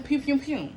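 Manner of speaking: mouth gunshots. Pretend. Imitating gunshots.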